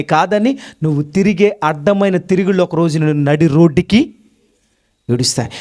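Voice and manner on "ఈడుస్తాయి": ordinary talk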